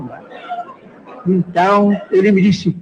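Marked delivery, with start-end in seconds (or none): none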